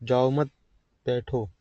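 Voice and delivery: group मत with जाओ, then pause before बैठो; pause after मत. Shown as a demonstration. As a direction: say बैठो apart, after a clear gap following मत. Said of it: "या दो तरीके से".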